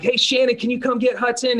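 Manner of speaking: high-pitched call to wife through the door; retold yell. Panicked dad calling his wife to come get their son, mock yell. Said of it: "And I'm screaming".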